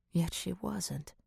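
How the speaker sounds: ordinary delivery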